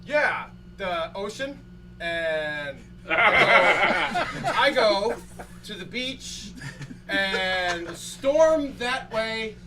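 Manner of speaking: slow, halting, stammering answer; slow, tongue-tied reply, rising loud at the end; flustered rambling. Retold or imitated. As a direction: fumble for words on camera. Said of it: "I totally clam up, and I'm just like".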